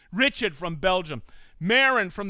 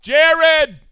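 call out; 8 semitones higher, 13 dB louder than usual. Real, not acted. Calling out a viewer's name.

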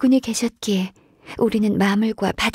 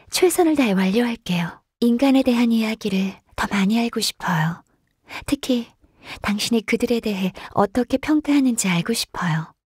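ordinary talk

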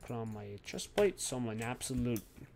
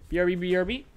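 upbeat brb call; 6 semitones higher, 7 dB louder, much faster than usual. Real, not acted. Announcing a break to viewers.

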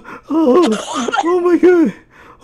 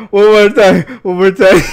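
ordinary talk, nothing marked

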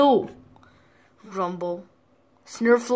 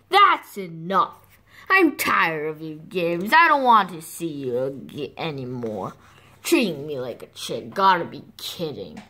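fed-up grumbling; 6 semitones lower than usual. Acted. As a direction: grumble away, tired of their games. Mommy storms off, fed up.

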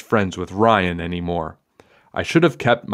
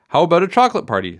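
eager; 8 semitones higher, 4 dB louder, faster than usual. A narrator's reading. Eager girl suggesting.

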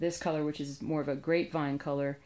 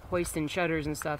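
ordinary talk

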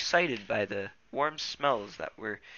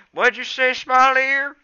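loud; 14 semitones higher, 12 dB louder, slower than usual. A narrator's reading. Deaf elder asking loudly.

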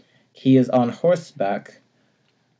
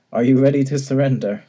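normal talking voice

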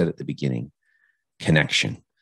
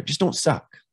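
higher, pumped-up pep talk; pep-talk mantra; 10 semitones higher than usual. Retold or imitated. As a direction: cheer them on with the mantra, pitched up. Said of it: "her mantra is".

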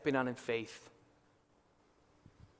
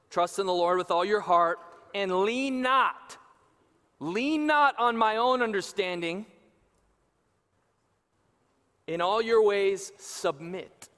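loud, slow, higher preached verse; fervent scripture quote; 6 semitones higher, 7 dB louder, slower than usual. Acted. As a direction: proclaim the verse slowly and loudly. Preacher quoting Proverbs with force.

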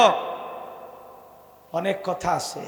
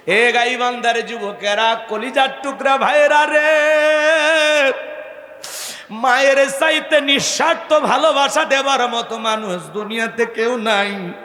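impassioned appeal; 5 semitones higher, 4 dB louder than usual. Acted. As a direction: plead with the young men. Preacher appeals to youth.

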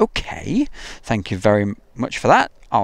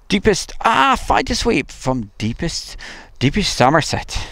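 higher-pitched mock Somerset accent; badly faked Somerset accent, lightly done; useless Somerset accent; 7 semitones higher than usual. Streamer greeting a Somerset viewer, failed Somerset accent. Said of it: "that was not an accent from Somerset. That was useless".

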